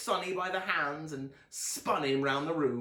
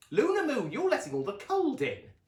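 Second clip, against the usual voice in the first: higher, louder calling mother; Mum calling; 12 semitones higher, 6 dB louder than usual. A narrator's reading. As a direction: Mum calls Luna in from the snow, high loud call. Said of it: "said Mum".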